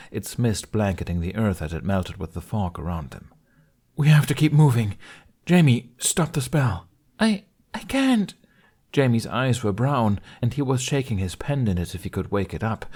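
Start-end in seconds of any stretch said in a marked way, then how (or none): 4.0-6.9 urgent. Acted. Fleeing visions, urging Jamie. 7.2-8.3 put-on high, slow frightened child's voice; a small boy who can't stop the spell; scared stammer.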